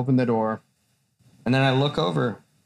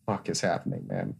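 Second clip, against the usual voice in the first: much softer, lower, dazed; 8 semitones lower, 9 dB quieter than usual. Retold or imitated. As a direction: mutter it in a daze. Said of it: "I was just like".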